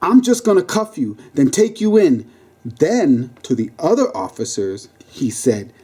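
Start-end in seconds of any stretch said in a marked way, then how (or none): 0.0-2.3 impatient cop, pitched up; forceful, impatient order. Acted. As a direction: lay it down forcefully, voice raised in pitch. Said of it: "forcefully saying".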